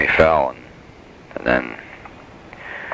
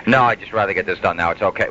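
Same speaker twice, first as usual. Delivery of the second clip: normal talking voice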